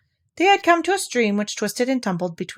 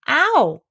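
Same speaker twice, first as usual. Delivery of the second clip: yelp of pain; 8 semitones higher, 6 dB louder than usual. A narrator's reading. Pooh sat on thistle.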